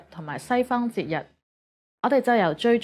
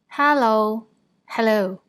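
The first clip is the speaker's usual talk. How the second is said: Cantonese accent; 3 semitones higher, 4 dB louder, slower than usual. Shown as a demonstration. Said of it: "but with a Cantonese accent".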